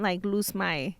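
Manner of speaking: ordinary talk, nothing marked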